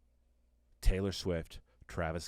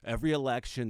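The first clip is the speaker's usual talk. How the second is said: normal talking voice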